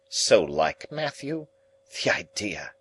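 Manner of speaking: fussy lady voice. A narrator's reading. Camilla complaining.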